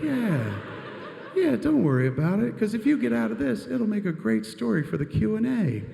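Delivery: high, laughing stoner reassurance; giggly reassurance. Pretend.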